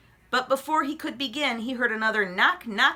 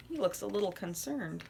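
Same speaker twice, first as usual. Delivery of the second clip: quiet aside; 9 dB quieter than usual. Real, not acted. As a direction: murmur it. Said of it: "aside to listener".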